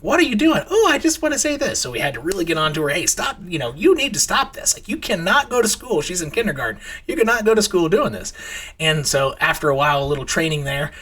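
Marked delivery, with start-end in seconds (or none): 0.0-0.6 high, louder exclaimed question; startled dad demanding an explanation; parental challenge. Retold or imitated. Parent catching child swearing. 0.7-1.8 high-pitched mock child voice; lightly acted little-girl reply; imitated little kid. 2.9-6.0 high, insistent telling-off; exasperated dad, retold; parental scolding. 7.1-8.3 parental scolding. Retold or imitated. Retold scolding of daughter.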